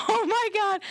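high-pitched mock dismay; freaking out. Doula reacting to her client's answers, retold freak-out. Retelling own shocked inner reaction.

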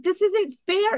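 imitating a crying complaint; on the verge of tears. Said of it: "And she starts crying".

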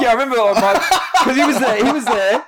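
giggling as the words come out; laughing through a funny memory. Real, not acted. Laughing while telling barber story.

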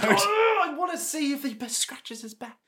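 very high, fast, giddy; high giddy fangirl gush fading away; excited squeal. Real, not acted. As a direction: squeal with delight, then fade off.

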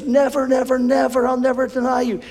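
high, insistent never repeated; Peter's vehement protest. Acted. Preacher voicing Peter refusing, high vehement protest.

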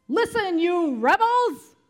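high, loud and slow rebuke; shouted. A narrator's reading. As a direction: bellow it at the rebels. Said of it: "he shouted".

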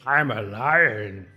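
very slow, proud lion declaration. Pretend. Conductor admiring herself as a lion, slow and proud. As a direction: savour every word like a beast. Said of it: "saw my reflection".

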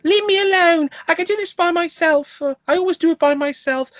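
put-on very high, loud, strained childish whine; mock the bickering girl's piercing voice; hammed-up teenage whine; whiny real girl impression. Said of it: "the real girl who talks like".

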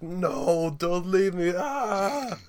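high, loud, desperate wail; screaming. Acted. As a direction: scream it out, rising to a cry.

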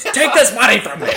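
high, loud hammed-up shout; mock yell. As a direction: yell it way over the top. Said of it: "she goes".

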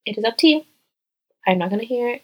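ordinary talk

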